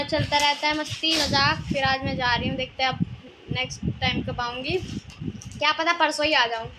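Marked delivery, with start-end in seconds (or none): none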